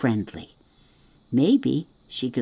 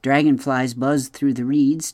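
usual speaking voice

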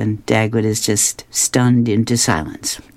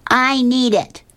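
put-on much higher girl's voice; bossy little girl explaining; Lucy in a high voice, matter-of-fact; 9 semitones higher than usual. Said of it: "And Lucy says".

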